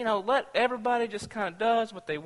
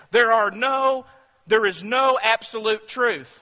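louder, insistent mock claim; emphatic quote; 4 semitones higher, 7 dB louder than usual. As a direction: declare it like a smug slogan. Quoting relativist statement.

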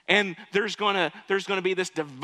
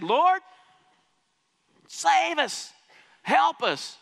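high, louder pleading cry; crying out to God; 9 semitones higher, 3 dB louder, slower than usual. Retold or imitated. Slaves in Egypt cry to God, high pleading cry. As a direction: beg God for rescue. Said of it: "They cried out to God".

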